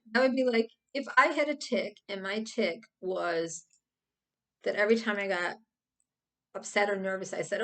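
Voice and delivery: ordinary delivery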